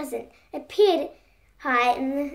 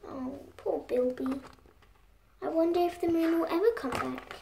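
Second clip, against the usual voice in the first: much softer, pitying sigh of a line; sad aside; 3 semitones higher, 8 dB quieter than usual. Acted. Reader pities Bilby softly to the children. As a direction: feel sorry for Bilby, very soft.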